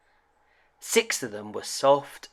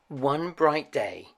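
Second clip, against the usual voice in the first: ordinary delivery, nothing marked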